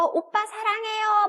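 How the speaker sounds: high, slow fan call; fangirl cry. Acted. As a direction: imitate an adoring K-pop fan. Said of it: "막 이런 거 하잖아요".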